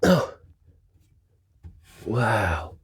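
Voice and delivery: usual speaking voice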